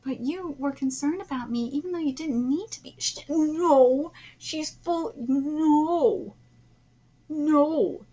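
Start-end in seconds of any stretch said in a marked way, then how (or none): 3.0-8.1 loud, insistent noes; real indignant interruption; exasperated protest. Real, not acted. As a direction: object sharply, cutting yourself off. Breaks off reading to object.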